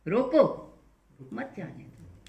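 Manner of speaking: a sharp command on रोको, break before मत; commanding stop order. Shown as a demonstration. Teacher repeats the command for students: loud रोको, pause, मत जाने दो. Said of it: "It's a command".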